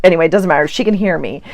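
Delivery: ordinary talk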